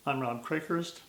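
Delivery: ordinary delivery, nothing marked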